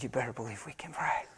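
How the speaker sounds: unvoiced, soft whisper. Father answering his son's plea to pray, whispering. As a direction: breathe it gently to the child.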